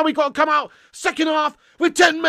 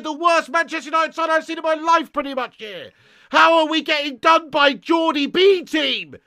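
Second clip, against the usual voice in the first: normal talking voice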